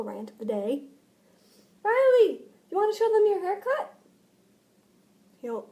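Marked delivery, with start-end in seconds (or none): 1.8-3.9 high, coaxing call. Real, not acted. Vlogger invites Riley to show a haircut, high coaxing call. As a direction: call over in a raised pitch. Calling Riley over to camera.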